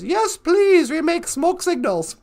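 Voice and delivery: very high, loud, drawn-out voice; high-pitched hammed-up begging; quoted fan's plea. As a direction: squeal it out slowly like an eager fan. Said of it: "She says".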